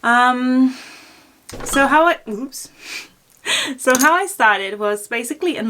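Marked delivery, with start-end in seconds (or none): none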